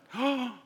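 sharp high gasp of alarm; acted gasp of worry; panicked gasp. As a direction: gasp as if struck by fear.

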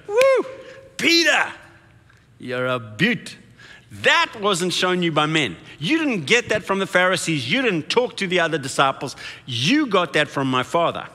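bursting with joy, pitch leaping on the woo; excited Jesus. Retold or imitated. Jesus cheering Peter's answer, excited. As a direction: cheer him on like a proud friend. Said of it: "Jesus gets very, very excited about that answer".

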